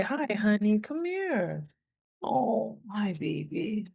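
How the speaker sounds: high, softening coo; cooing to a pet in a small voice. Real, not acted. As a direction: coax a beloved pet over. Calls pet over, baby talk.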